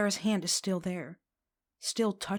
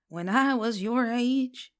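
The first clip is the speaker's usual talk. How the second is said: higher, louder and slow mock old crone; 5 semitones higher, 5 dB louder, slower than usual. A narrator's reading. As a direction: ham up a creaky old crone. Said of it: "she says in a graggy old crone voice".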